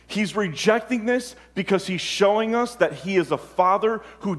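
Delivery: high, loud impassioned preaching; a preacher pouring out God's plea, fervent; preaching at full force. Acted. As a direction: build it like a pulpit climax.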